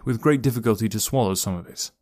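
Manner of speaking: plain reading voice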